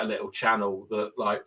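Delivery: normal talking voice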